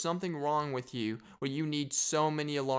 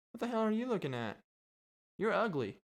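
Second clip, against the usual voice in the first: lightly voiced heckling parrot, higher and quick; 7 semitones higher, faster than usual. Cursing parrot to park visitors, lightly squawky. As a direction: insult like a cheeky parrot.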